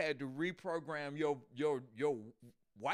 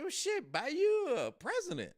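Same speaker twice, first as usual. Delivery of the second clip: high, louder hammed-up advice; brazen suggestion; 10 semitones higher, 5 dB louder than usual. Woman advises the man to buy power, high and emphatic. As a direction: pitch it as the obvious fix, voice up high.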